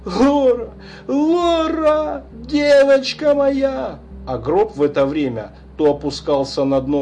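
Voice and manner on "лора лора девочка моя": anguished crying out. Acted. Father calls his dead daughter, anguished shout. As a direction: call her name in despair. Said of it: "вновь закричал".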